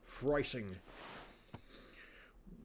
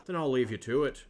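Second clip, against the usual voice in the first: normal talking voice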